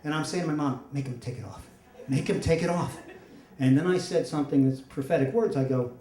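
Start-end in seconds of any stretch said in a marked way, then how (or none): 0.9-1.7 low, much softer mortified plea; hushed plea. Retold or imitated. Embarrassed son pleads to mom. 2.1-3.0 acted urgent plea at normal volume; insistent plea. Embarrassed son repeats plea more insistently.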